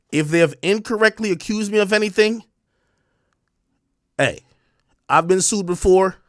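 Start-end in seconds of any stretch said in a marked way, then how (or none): none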